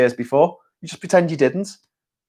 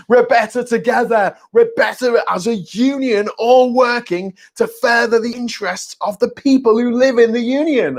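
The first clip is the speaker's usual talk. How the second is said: higher, pompous put-on voice; mock unionist; 8 semitones higher than usual. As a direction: parody a smug unionist speech.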